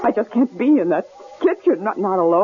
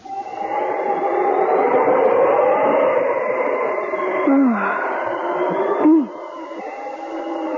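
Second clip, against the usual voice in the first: long sigh; 6 semitones higher than usual. Acted. Alone after terror, resigned.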